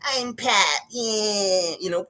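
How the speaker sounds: lazy, shrugging coach voice, put on; shrugging coach impression. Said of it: "He shrugs his shoulders and goes".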